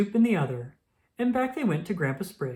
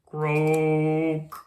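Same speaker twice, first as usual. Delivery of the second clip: a big froggy croak; booming toad croak; loud croak; 4 dB louder than usual. Acted. Toad sound effect in story.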